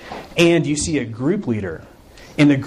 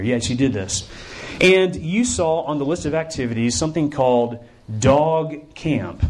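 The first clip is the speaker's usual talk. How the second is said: ordinary talk, nothing marked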